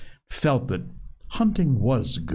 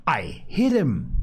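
indignant; 8 semitones higher than usual. A narrator's reading. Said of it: "Ralph said indignantly".

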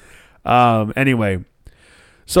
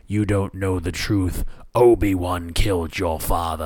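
slow, lower mock Vader delivery; Vader line; 5 semitones lower, slower than usual. Vader telling Luke the fake twist, slow and low. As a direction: intone it slow and low like a villain. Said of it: "the Vader says to Luke".

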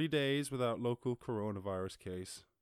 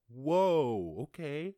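louder, startled whoa; taken-aback whoa; 5 semitones higher, 7 dB louder, much slower than usual. Retold or imitated. Retelling a reaction to the news, startled and louder. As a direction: recoil at the news. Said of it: "I'm like".

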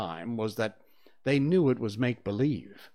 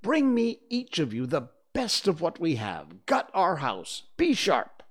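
raised, commanding pitch; boy captain rallying the others; urgent command, higher on the last words; giving orders; 6 semitones higher than usual. Acted. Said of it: "he ordered them".

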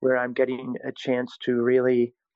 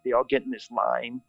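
ordinary delivery

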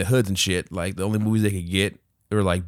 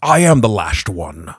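Sean Connery impression; 7 dB louder, slower than usual. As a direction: rumble it slowly like a dragon. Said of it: "Sean Connery being the voice of the dragon".

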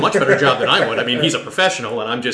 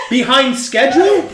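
higher, louder Behind schedule rising in outrage; villain outrage; 11 semitones higher, 6 dB louder than usual. Acted. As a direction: shout the repeated Behind schedule.

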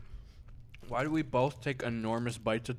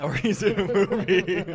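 laughing; 5 semitones higher, 6 dB louder than usual. Real, not acted. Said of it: "repeated with laugh".

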